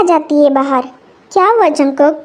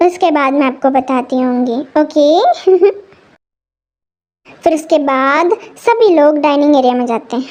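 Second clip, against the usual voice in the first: usual speaking voice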